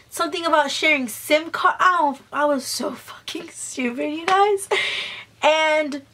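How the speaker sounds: ordinary delivery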